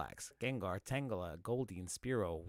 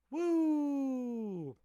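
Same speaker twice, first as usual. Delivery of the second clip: cheer; 10 semitones higher than usual. Real, not acted. Anniversary cheer.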